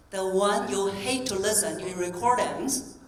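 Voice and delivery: usual speaking voice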